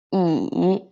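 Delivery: I as "ee", dipping then rising; third tone. Shown as a demonstration. Teacher drilling fourth line, i dipping. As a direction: take I ("ee") low and up. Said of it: "And the fourth line".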